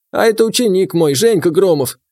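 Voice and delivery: put-on gruff old gamekeeper; old man voice.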